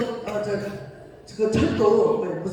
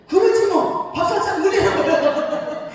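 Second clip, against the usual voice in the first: laughing agreement; 7 semitones higher, 5 dB louder, slower than usual. Retold or imitated.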